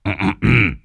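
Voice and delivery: gruff throat clear; low old man clearing his throat. Acted. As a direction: clear the throat to announce yourself. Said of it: "Lão Tam hắng giọng".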